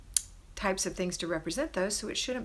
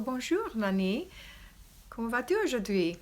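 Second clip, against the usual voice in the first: French greeting; 4 semitones higher than usual. Shown as a demonstration. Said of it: "That's about all the French I know".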